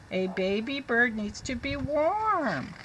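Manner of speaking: very slow, careful read-aloud; teacher reading to children. Shown as a demonstration. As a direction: read it slowly for the class.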